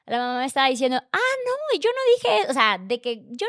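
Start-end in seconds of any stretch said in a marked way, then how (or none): 1.1-2.5 mock protest. Retelling the talk with his mother, high mock protest. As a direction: jump way up for a quoted denial.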